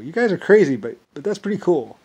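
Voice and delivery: a retold burst of amazement; excited admiration. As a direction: gush at them with delight. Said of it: "I was like".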